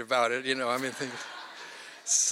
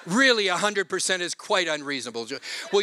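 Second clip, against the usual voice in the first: ordinary talk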